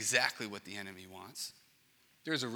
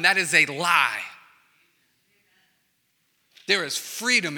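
preaching at full force; 4 semitones higher, 10 dB louder than usual. Acted. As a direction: build to a fiery pulpit cry. Preaching climax on guilt.

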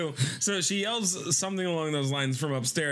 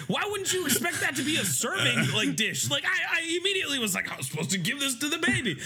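much higher incredulous rant; high disbelieving rant; mock exasperated rant; 9 semitones higher than usual. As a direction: let the outrage fly. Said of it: "And I'm like".

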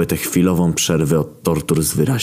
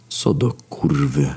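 slow, stunned half-whisper; frightened whisper; slower than usual. Acted. Death frozen as the gates slam shut, frightened whisper. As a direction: drag it out, hushed with dread. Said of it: "szepnął śmierć, sparaliżowany ze strachu".

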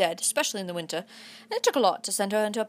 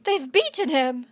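tearful; 5 semitones higher, 4 dB louder, slower than usual. A narrator's reading. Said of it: "the only time I've heard her cry".

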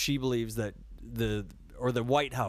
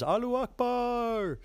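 much higher, slow hammed-up war cry; mock deer battle cry; 13 semitones higher than usual. Joking that the deer are suicide attackers, hammed-up war cry. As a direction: cry it out like a charging fanatic. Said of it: "the last thing I heard before I hit the deer was".

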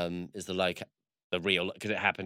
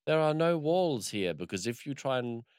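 higher-pitched, lightly acted colonel's declaration; retold commandant's warning; 6 semitones higher than usual. Colonel to new prisoners in the jungle camp, mock-declaring. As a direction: announce the camp rule as a retold quote.

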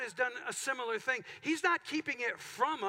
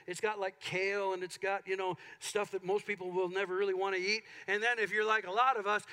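normal talking voice

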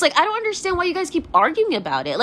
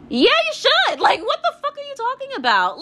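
high-pitched, incredulous outburst; exasperated retort; 11 semitones higher than usual. Real, not acted. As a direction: fire back in real exasperation. Retorting to influencers' excuses in rant.

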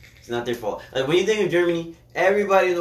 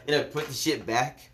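ordinary delivery

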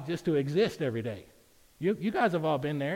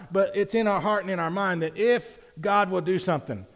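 usual speaking voice

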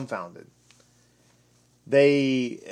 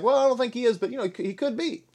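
higher voice, a noncommittal shrug in it; imitating a doubter; 9 semitones higher, much faster than usual. Preacher voicing believers hedging on the Messiah, mock doubtful. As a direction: play the hedging doubter lightly. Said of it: "they're like".

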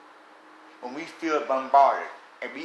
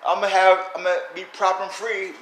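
mocking naive believer; 7 semitones higher, 6 dB louder than usual. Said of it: "And people think".

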